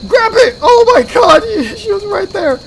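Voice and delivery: high, loud shout of terror; panicked shouting. Real, not acted. Player chased while grabbing, panicked shout. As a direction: shout in panic, a laugh slipping in.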